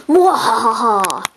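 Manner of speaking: drawn-out villain laugh, falling; slow evil cackle. Acted. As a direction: cackle slowly like a cartoon villain. Villain plotting evil twin.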